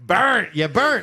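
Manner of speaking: shouted taunt, high and loud; loud teasing "burnt". Real, not acted. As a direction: call out the burn loudly. Teasing burn call.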